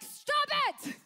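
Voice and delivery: high loud stop it; mock yell. Bob Newhart in her head stopping wandering thoughts, mock yell. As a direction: snap her mind back. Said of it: "Bob Newhart will be like".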